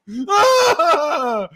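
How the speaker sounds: high, loud fake bawl; hammed-up wailing cry; mock angry sob. Host playing a mad Scorpio in tears, mock bawling. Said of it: "will cry when they mad".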